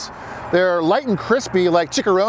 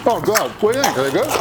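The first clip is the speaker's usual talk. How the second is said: shouting over machinery; 3 semitones higher, faster than usual. Real, not acted.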